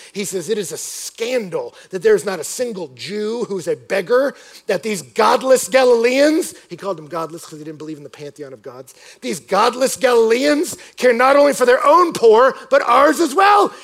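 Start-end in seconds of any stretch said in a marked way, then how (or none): 0.5-6.5 raised, emphatic indignation; imitating an exasperated ruler; frustrated emperor. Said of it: "he wrote to one of his men in frustration". 9.2-13.7 high, loud indignation; imitating a ruler's outraged rant; frustrated emperor. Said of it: "he wrote to one of his men in frustration".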